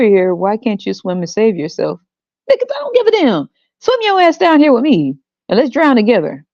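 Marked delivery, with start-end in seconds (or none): 2.5-6.4 very high, hammed-up yelling parent; mock furious parent. Parent yells at the kid to drown with them, in a high mock yell. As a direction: scream back at the kid.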